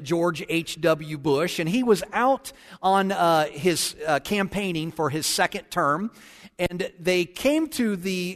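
ordinary talk

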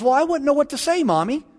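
lightly voiced small child, pitched high; little girl. Six-year-old daughter answers Mommy at dinner, lightly voiced little girl. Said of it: "the little girl says".

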